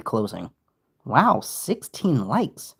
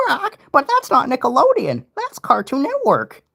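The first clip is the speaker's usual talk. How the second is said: higher squawky parrot voice; Potty the Parrot; 13 semitones higher, 3 dB louder than usual. Retold or imitated. Parrot correcting the pirate in a high squawk. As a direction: chirp it like a cartoon parrot. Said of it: "Can you do an impression of Patchy and Potty?".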